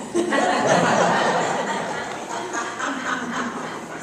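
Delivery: long helpless laugh; real laughter at the husband's fear; burst of laughter. Real, not acted. Storyteller laughs at her husband's possum worry. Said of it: "keep my laughing inside".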